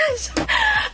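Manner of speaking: high-pitched burst of laughter; real, squealing laugh. Real, not acted. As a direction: crack up mid-story. Cracks up telling her story.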